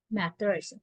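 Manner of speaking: ordinary talk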